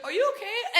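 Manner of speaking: put-on a light French accent on are you okay. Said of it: "She only spoke French".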